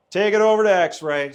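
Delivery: an officer waving a trucker to the X-ray bay; barked border order. Retold or imitated. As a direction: bark it like a border guard.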